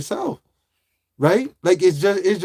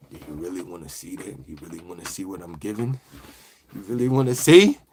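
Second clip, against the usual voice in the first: deep mock voice; 12 semitones lower, 4 dB quieter than usual. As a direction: drop into a deep spooky tease. Teasing chat who asks to see.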